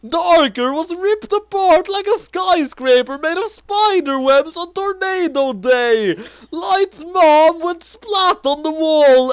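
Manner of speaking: high, loud, slow melodramatic reading; hammed-up climax. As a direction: read the climax like a disaster movie trailer. Climactic over-the-top narration.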